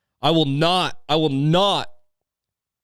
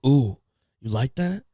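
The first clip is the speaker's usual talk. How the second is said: self-mocking dirty talk. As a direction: ask it like an unsure lover, in your own accent. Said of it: "making fun of yourself".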